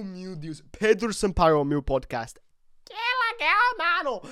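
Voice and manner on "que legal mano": a burst of giddy delight; high excited squeal. Real, not acted. Vlogger squealing with joy at his own podcast.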